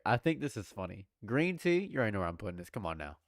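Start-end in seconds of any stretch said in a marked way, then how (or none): none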